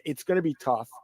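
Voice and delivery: ordinary delivery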